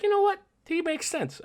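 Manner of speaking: squeaky mock-agreeing viewer; a viewer voiced very high, grudgingly agreeing. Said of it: "It's like".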